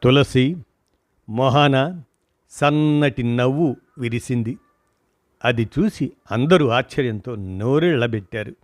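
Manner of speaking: usual speaking voice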